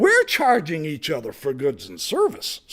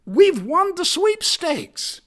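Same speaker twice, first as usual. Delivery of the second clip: higher and louder, thrilled; excited exclaim; 9 semitones higher, 5 dB louder than usual. Retold or imitated. Preacher imagining winning the sweepstakes, thrilled. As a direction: cry it out like you just won. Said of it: "I said".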